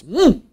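loud, high wordless grunt; imitated exasperated grunt; Jimmy Stewart. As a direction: give up on words with an emphatic "mm". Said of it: "Jimmy Stewart does this".

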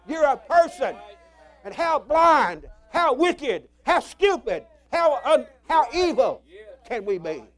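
full-force preaching; preaching shout. Real, not acted. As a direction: thunder each word at the crowd.

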